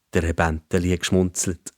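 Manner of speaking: ordinary delivery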